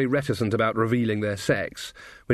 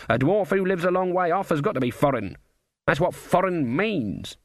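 put-on higher-pitched, opinionated old witch; lightly voiced Granny Weatherwax; 6 semitones higher than usual. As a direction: insist on it as a stubborn granny. Narrator voices Granny arguing.

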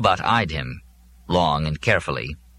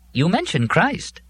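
lightly raised, slow robot delivery; a pedantic robot answering; 11 semitones higher, slower than usual. A narrator's reading. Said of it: "robot answers Molly".

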